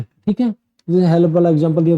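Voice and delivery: usual speaking voice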